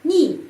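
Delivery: Ни with a clear "ee" vowel, high and loud; emphatic model syllable Ни, "nee". Shown as a demonstration. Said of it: "Bunyinya i, bukan е".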